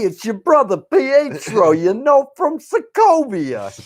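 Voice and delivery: high and drawn out, a hammed-up foreign cousin; mock Pietro. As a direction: ham up a fake Sokovian brother. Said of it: "it's like".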